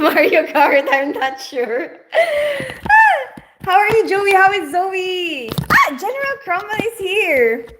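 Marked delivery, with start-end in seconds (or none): 2.1-3.4 loud, very high squealed laugh. Real, not acted. Laughs while chatting with viewers. 3.6-7.6 excited greeting. Real, not acted. Excitedly greeting arriving regular viewers.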